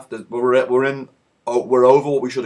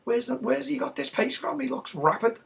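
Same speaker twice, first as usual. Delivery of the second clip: high, soft amazed murmur; surprised crowd; 10 semitones higher, 7 dB quieter than usual. Acted. Fans amazed at the sub's pace, high-pitched surprise. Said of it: "Everyone's like".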